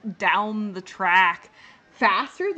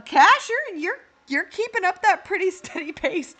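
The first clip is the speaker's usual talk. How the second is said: ordinary talk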